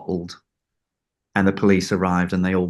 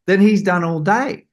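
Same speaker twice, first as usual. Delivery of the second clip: higher, slow emphatic praise; praising John; 8 semitones higher than usual. Retold or imitated. Storyteller voices John praising the assistant, higher and slow. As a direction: drive the compliment home, slowly and pitched up. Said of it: "He said".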